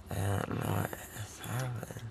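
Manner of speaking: hushed groggy whisper. Real, not acted. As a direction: breathe it out, dazed. Drugged patient, whispered.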